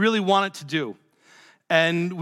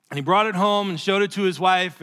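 ordinary delivery

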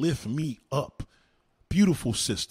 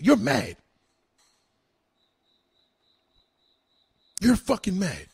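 angry jab; 5 semitones higher, 4 dB louder than usual. Real, not acted. Ranting insults at a rival.

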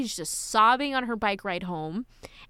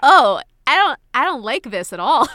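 much louder, a hammed-up yelp of refusal; loud mock dismay; 4 semitones higher, 12 dB louder than usual. Heroine balks at the reveal, loud mock dismay. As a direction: blurt out mock horror. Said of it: "and it's like".

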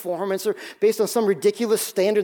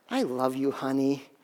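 imitating his wife, lower and softer; tender; 7 semitones lower, 6 dB quieter, slower than usual. Husband retelling his wife's gentle words to him. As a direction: quote her tender words softly and lower.

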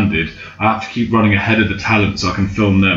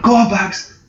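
mock cheering; 10 semitones higher than usual. Crowd cheering him up the cliff.

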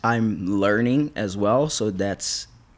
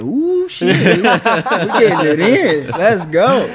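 high, loud and laughing hype; hyped up; 10 semitones higher, 9 dB louder, slower than usual. Retold or imitated. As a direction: get pumped up, laughing. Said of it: "I'm just here".

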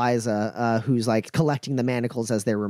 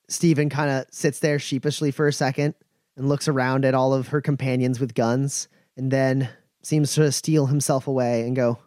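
ordinary talk

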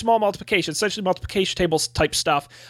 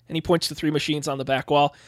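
ordinary delivery

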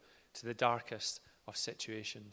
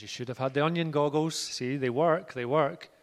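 teasing son-in-law; 3 semitones higher, 7 dB louder than usual. Retold or imitated. Phil ribbing Jay about the onion goggles, playful. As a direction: gloat gently that the goggles work.

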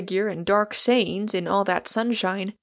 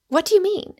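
higher, louder startled query; quick question; 11 semitones higher, 6 dB louder, faster than usual. A narrator's reading. Rosamond questions Michael about his remark, quickly and urgently. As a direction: ask it quickly, pitched up. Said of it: "asked Rosamond quickly".

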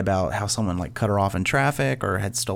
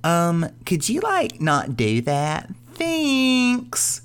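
high, slow sarcastic mom drawl; sarcastic suburban mom, sickly sweet; high and slow, mock-polite soccer mom; 7 semitones higher, much slower than usual. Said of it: "And I said in my voice".

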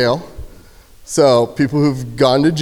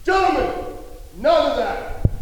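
much higher, commanding bark at the dorm; sharp guard command across a dorm; jail guard voice; 12 semitones higher, 5 dB quieter than usual. Shown as a demonstration. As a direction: call the whole room to order. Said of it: "my get jail guard voice".

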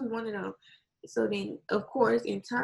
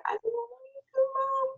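high, mock-crying voice; retold tearful apology; 14 semitones higher, slower than usual. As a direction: imitate a daughter tearfully apologizing, much higher. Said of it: "she started crying".

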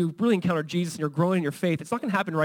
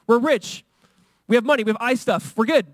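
mock boasting; 6 semitones higher, 5 dB louder than usual. Laodiceans boasting again.

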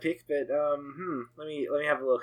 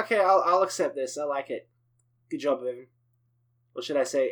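ordinary talk, nothing marked

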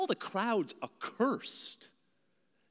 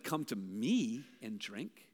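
hushed invitation; 9 semitones lower, 7 dB quieter, slower than usual. Retold or imitated. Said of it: "no one's ever said".

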